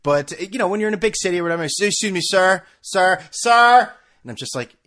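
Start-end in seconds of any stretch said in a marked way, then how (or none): 1.6-3.9 stranger calling louder each time, ending in a loud shout; urgent street call, building to a shout; calling out, rising. Retold or imitated. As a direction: call him back harder with every sir. Homeless man calling after him.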